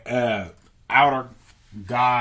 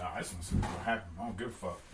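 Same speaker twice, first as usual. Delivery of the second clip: careless mad scientist; 7 semitones lower, 12 dB quieter, much faster than usual. Acted. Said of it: "He's like".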